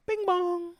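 high sung doorbell chime; ding-dong like a doorbell. Retold or imitated. Telling the story of the night doorbell, high chime imitation.

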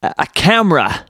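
bad American accent, keynote presenter; Steve Jobs. Host unveils the drone, terrible American launch accent. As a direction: announce it in a hammed-up American accent. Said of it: "This is the worst American accent ever".

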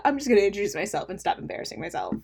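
usual speaking voice

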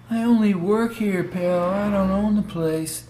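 slow, lazy stoner drawl; laid-back hippie. Acted. Attendant voiced.

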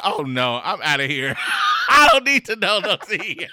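loud, laughing "I'm outta here"; laughing mock panic. Imagining fleeing the waiting room, loud and laughing. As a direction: blurt it out while laughing. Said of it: "you be like".

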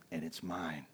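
low, hushed secret thought; covetous inner voice. Retold or imitated. Hunter privately wanting the big deer, low and covetous. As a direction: quietly claim it for yourself.

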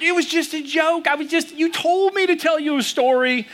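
high-pitched, defensive mock protest; flustered backtracking. Dad backtracks to freaked-out daughter, high mock fluster. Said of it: "trying to backtrack".

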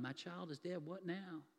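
soft, hollow, stunned words; numb grief. Retold or imitated. Grieving father walking home after the news, soft and numb. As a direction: say it emptied out, too numb to cry. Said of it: "he's numb".

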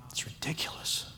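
breathy whisper. Real, not acted. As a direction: whisper it close to the mic. Repeated as a whisper.

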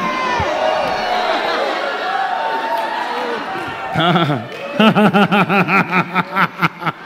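soft rolling laugh, drawn out; long quiet chuckle; real laughter. Real, not acted. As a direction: overcome with genuine laughter. Preacher breaks into laughter.

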